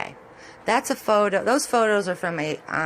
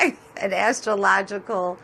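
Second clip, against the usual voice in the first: laughing. Real, not acted. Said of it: "I can't even say it".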